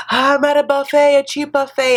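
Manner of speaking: high, loud mock thrill; gasping excitement. Recapper playing an eager diner at the buffet, gasping excitement. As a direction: gush it in exaggerated delight.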